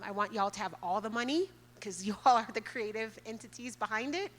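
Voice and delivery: normal talking voice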